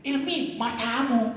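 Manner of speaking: a slow, lightly acted rude jab at a friend; mock-insult the friend, drawing it out. Imitating an insult thrown at friend Ilmi, slow mock rudeness. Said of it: "Kalau dikatakan begini misalnya".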